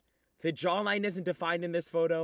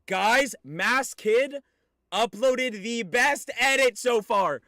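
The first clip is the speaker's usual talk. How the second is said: hyped announcement; 5 semitones higher, 6 dB louder, slower than usual. Real, not acted. Hyping the edit.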